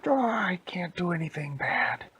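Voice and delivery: high-pitched lament; hammed-up witch's complaint; exasperated witch. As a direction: wail it in mock despair. Witch laments failed evil.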